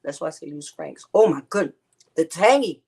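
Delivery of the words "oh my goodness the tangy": loud excited exclamation. Real, not acted. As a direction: exclaim at the taste. Tasting exclaims.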